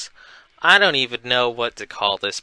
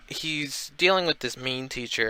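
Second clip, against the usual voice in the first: usual speaking voice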